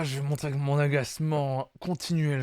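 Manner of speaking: normal talking voice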